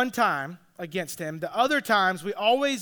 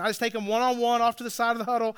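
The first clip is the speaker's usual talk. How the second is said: usual speaking voice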